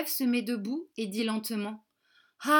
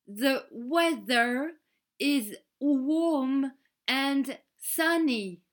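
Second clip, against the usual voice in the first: spoken very slowly, word by word; "The weather is warm and sunny" drawn out slowly for learners; much slower than usual. Shown as a demonstration. Kelly to French pupils, spelling it out very slowly. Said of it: "Très lentement, pour nous aider à comprendre".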